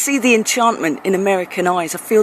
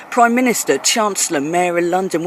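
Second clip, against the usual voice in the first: usual speaking voice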